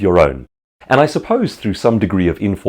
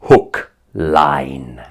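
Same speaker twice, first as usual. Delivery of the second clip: Jeremy Irons impression; 4 semitones lower, much slower than usual. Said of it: "Jeremy Irons has some great lines".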